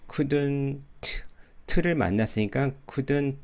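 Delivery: "couldn't" with a separate final teu; added eu vowel. Shown as a demonstration. Teacher imitating learners' habit, "couldn't" with tacked-on teu. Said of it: "을을 꼭 넣어주려고 하죠".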